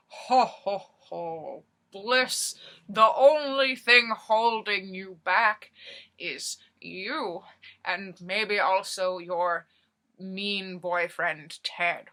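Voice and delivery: a lightly hammed-up jolly Santa, loud ho ho hos. Santa in disguise advising Bliss at the bar, lightly hammed-up jolly voice. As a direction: play a jolly mall Santa giving cheesy advice. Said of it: "'cause he was Santa".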